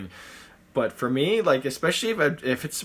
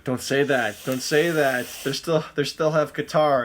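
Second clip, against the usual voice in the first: mock protest; 4 semitones higher, 8 dB louder, slower than usual. Real, not acted. Protesting about aging star.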